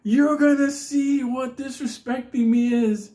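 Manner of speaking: high, loud, slow threat; the Unabomber raging. Retold or imitated. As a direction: hold the dog up and bellow the threat. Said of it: "he goes".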